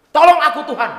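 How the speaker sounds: loud high cry for help. Acted. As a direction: scream for rescue, dangling. Atheist crying for help. (berteriak minta tolong)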